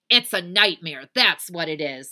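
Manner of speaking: loud, emphatic grumbling; Eugenia Lincoln. Acted. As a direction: insist crossly at full voice. Bossy sister insisting.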